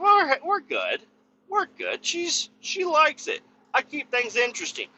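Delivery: sheepish. Real, not acted. Defensive joking about marriage.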